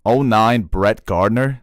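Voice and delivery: slow, disbelieving repeat with a rising end; mock his line back in disbelief; stretched-out incredulous question; incredulous echo. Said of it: "the most Teddy thing".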